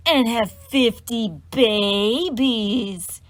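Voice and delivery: very slow, loud drawn-out taunt; mocking sing-song. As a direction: savour the tease word by word. Cecilia's teasing punchline.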